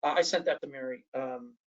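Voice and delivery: usual speaking voice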